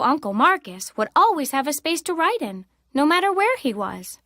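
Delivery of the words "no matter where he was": ordinary delivery, nothing marked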